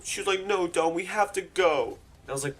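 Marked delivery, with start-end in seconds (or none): 0.4-2.0 high, slow drunk-friend impression; whining mock-drunk insistence; imitated drunken pleading. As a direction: plead with drawn-out tipsy vowels. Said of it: "drunken slurs and shit".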